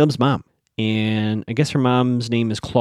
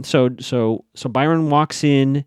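usual speaking voice

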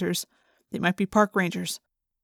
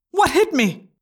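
high startled cry, a bit louder; 7 semitones higher, 4 dB louder, slower than usual. A narrator's reading. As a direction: shaken by a blow from nowhere. Yeerk struck by unseen owl.

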